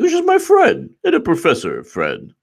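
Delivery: hammed-up innocent act, pitched well up; mocking Comey. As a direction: play it up as a fake-sweet excuse.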